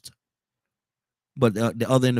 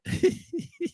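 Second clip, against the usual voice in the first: short laugh; 3 dB quieter than usual. Real, not acted. Laughs before greeting the caller.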